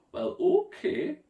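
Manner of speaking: a high, drawn-out friendly monster voice; high, slow Nessie okay. A narrator's reading. Nessie agreeing to swim with the children, high and slow. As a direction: stretch it out high and kind.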